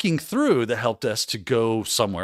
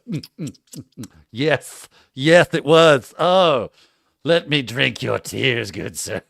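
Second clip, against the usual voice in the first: hammed-up villainous relish, humming then louder; mock gloating; 5 dB louder, slower than usual. Mocking the defeated help desk manager, hammed-up gloating. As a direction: savor the win like a smug villain. Savoring vindication over manager.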